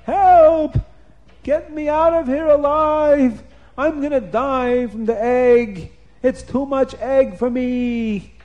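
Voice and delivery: high, loud, drawn-out cries; screaming for help. A narrator's reading. Nimrod drowning in egg, screaming for help. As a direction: yell in panic as the drowning king. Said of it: "Nimrod is screaming".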